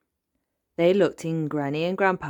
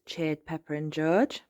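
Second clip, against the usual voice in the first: ordinary delivery, nothing marked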